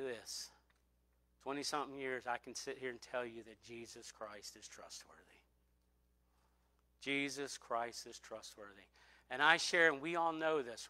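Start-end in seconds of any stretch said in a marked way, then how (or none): 2.3-8.9 hushed testimony. Real, not acted. Pastor to his congregation, hushed and earnest. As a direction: let the truth land softly.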